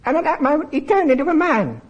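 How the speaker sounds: high squawky parrot voice. Acted. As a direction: talk like a parrot. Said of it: "I'm gonna keep this parrot voice up".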